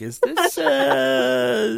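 high squeaky scandalized voice; mocking a stranger eyeing the Four Loko; mock shocked asker. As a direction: ask it like a prim busybody, up high. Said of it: "they're like".

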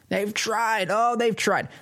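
high, mocking sarcastic repeat. Real, not acted. Radio host mocking the governor, sarcastic and high. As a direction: scoff at how hard they tried.

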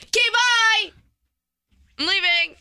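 high, bright-voiced exit; playful high farewell; sing-song goodbye. Real, not acted. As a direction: chirp the goodbye high as you leave. Abruptly leaving the show.

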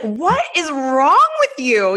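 slow, high, drawn-out disbelief; mock exasperation. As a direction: imitate a husband at his wits' end. Husband's exasperated repeat question, retold.

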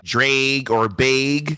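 drawn-out Midwestern "drag or bag", vowels like "drayg or bayg"; Midwestern accent. Shown as a demonstration. Friend hiding their home accent avoids this, Midwestern "drag or bag". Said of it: "their Midwestern accent".

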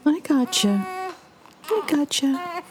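put-on high, gentle cooing to a baby; soothing baby. Mother soothing crying baby.

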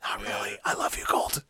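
soft, whispered confession; whispered aside. Acted. Co-host apologizing to the mocked listener, whispered aside. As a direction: drop to a whisper.

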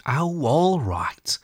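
high, very slow, drawn-out assent; good-natured giving in. A narrator's reading. Rat lets tired Mole rest, good-natured and drawn out. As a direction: give in indulgently, stretching the words. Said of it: "said the good-natured rat".

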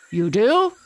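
high, loud surprised question; surprised lion. A narrator's reading. Hungry lion hears the boy has a comb, high and loud in surprise. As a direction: perk up, suddenly interested. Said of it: "said the lion".

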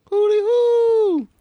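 high, slow owl call; owl hoot. Pretend. Mimicking owls in the neighborhood, high slow hoot.

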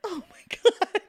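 high, drawn-out mock horror; mortified. Realizing she wrongly blamed her brother, retold mortified horror. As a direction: imitate being aghast at yourself.

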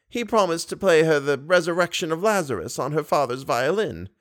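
put-on high, fond old lady voice; tender grandmotherly coaxing. A narrator's reading. Old lady calls Raoul near like a boy, high.

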